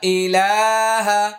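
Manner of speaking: slow, drawn-out Quranic recitation. Shown as a demonstration. Teacher modelling the phrase for learners to memorise, slow chant. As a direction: draw each vowel out like a reciter. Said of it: "আবার আয়না ধরুন".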